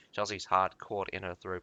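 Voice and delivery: ordinary delivery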